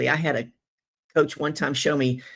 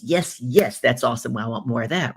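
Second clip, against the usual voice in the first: ordinary delivery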